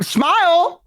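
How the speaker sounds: photographer calling. Retold or imitated. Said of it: "and they're like".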